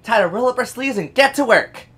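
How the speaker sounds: high, loud character voice; put-on character voice. Streamer trying out a voice for Dorothy, high and loud. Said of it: "That's probably the voice I'm".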